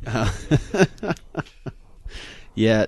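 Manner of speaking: ordinary talk, nothing marked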